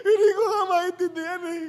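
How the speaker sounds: very high, loud crying voice; wailing sob. Acted. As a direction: widow crying even harder. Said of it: "Umiyak lalo".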